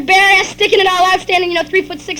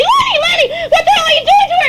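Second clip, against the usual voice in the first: high, loud alarmed yell; 11 semitones higher, 5 dB louder, faster than usual. Acted. Mother yelling at father from the stairs, alarmed yell. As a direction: yell it out, pitch way up. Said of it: "tripping halfway up and yelling".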